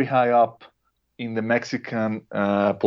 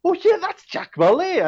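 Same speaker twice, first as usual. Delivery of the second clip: much higher, eager spotting of familiar faces; excited recognition; 13 semitones higher than usual. Retold or imitated. Guest recalling watching the documentary, excitedly surprised. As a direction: gasp in delight at each face you know. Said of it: "and I was like".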